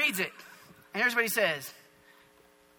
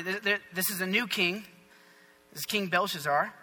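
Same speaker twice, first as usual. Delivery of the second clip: normal talking voice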